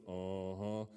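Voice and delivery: low, drawn-out knowing uh-huh; Bubba. Retold or imitated. Seatmate catching him watching girls, low knowing imitation. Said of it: "I hear Bubba sitting next to me goes".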